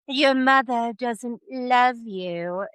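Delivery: put-on slow, drawn-out aristocratic English accent; slow upper-class British baby drawl; posh monarchy drawl. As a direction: drawl it like a tiny royal. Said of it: "it drawls in the accent of the monarchy".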